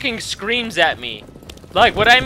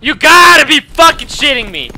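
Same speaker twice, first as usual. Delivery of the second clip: higher, louder yell of disbelief; escalating outrage; 7 semitones higher, 9 dB louder than usual. Real, not acted. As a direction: hammer it out in rising fury. Repeats it, angrier.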